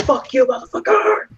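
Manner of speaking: very high, strained mock shout; defiant yell. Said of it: "And I was like".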